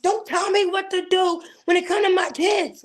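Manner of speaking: high, heated reenacted outburst; retold snapping back. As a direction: cut off advice, pitched high and defensive. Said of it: "I didn't mean to come off like that".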